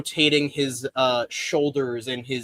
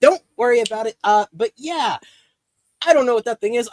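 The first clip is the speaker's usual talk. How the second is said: brushing it off; 8 semitones higher, 5 dB louder, faster than usual. Acted. Boss dismisses, louder.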